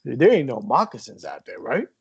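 a light put-on North Jersey dad accent; mock the dad's North Jersey accent; lightly imitated North Jersey dad; fake North Jersey accent. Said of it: "I love the fake accent that you gave him".

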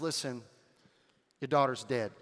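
low, blunt delivery, no sensitivity; stone cold. Retold or imitated. Servant giving Jairus bad news, stone cold and low. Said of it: "I mean, that's how he says it".

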